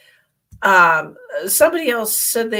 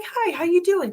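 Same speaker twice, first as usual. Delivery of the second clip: cheery greeting; 11 semitones higher than usual. Retold or imitated. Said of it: "I'm like".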